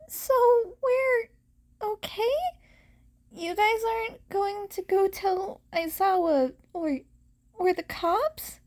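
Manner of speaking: high, nervous questioning; anxious young hero voice. Acted. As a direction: pitch up, worried he'll be reported. Izuku voiced anxious, higher.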